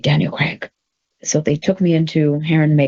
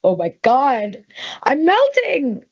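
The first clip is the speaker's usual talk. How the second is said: slow swoon, rising much higher; 7 semitones higher, slower than usual. Retold or imitated. His arm around her, she swoons, slow and rising high. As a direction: swoon slowly, pitch climbing.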